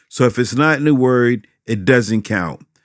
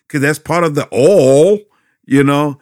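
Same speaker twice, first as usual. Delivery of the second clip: getting energized; 2 semitones higher, 5 dB louder than usual. Real, not acted. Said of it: "I'm getting a little energized here".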